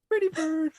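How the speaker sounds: very high, loud mock-childish coo; squeaky hammed-up kid voice; blind kid cooing. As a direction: coo it like a kid to a pet bird. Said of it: "The blind kid's like".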